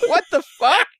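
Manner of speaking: high mock-shocked yelp. Waking to find Bill rubbing, high mock shock.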